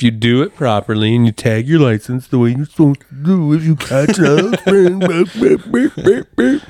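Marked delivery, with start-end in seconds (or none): 3.5-6.6 high, laughing beep beep beep; mock beeping. Showing a friend how tagging a fish goes, rising mock beeps. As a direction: squeak the beeps higher and higher. Mimicking tag machine beeps.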